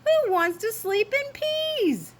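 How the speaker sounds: high, slow, incredulous kitten question; baffled outburst. Acted. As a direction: ask it in high-pitched disbelief. Kitten baffled by misheard word.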